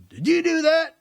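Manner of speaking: loud, high-pitched shout across a distance; shouting over. Retold or imitated. State trooper shouting over to an onlooker at the sign, shouted. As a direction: call it out as the state trooper would. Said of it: "he kind of shouts over to me".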